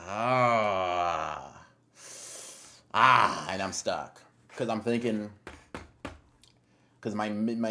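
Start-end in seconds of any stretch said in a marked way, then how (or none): 0.1-3.5 frustrated yell. Real, not acted. Stuck choosing fight list.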